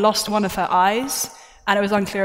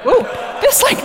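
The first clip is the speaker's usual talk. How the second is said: real voice crack jumping up high; sudden squeaky crack; 12 semitones higher, 4 dB louder than usual. Real, not acted. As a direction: voice nearly breaks on "Ooh". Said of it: "literally nearly broke on me".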